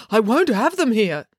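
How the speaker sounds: fussy dad. Acted. Dad voiced in story.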